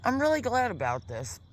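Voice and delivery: talking while chewing; mouth full. Real, not acted. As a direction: speak through a mouthful of meat. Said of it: "I'm sorry to talk with my mouth full".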